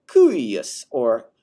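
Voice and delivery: slow, low and falling. Shown as a demonstration. Teacher demonstrating falling relative genitive. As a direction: let the words sink down. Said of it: "not only a lower pitch, but it's also falling".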